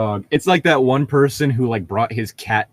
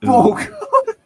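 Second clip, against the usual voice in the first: a sudden high exclamation of dismay; shocked; 3 dB louder than usual. Real, not acted. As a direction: recoil in disbelief. Reacting to breakup by text.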